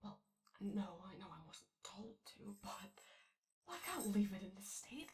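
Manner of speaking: hushed, half-whispered grumbling to herself; muttering to herself. Acted. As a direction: mutter your complaints under your breath, alone.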